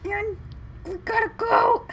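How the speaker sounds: high, loud panicked voice; frantic urging. Acted. Player voicing a character who has to get out, frantic. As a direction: urge them away in a high-pitched fluster.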